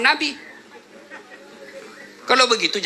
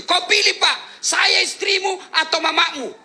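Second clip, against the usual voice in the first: high, slow shrill ultimatum; demanding wife; 9 semitones higher, slower than usual. Retold or imitated. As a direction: play the wife forcing a choice. Wife's ultimatum quoted. (istri yang menuntut, suara tinggi)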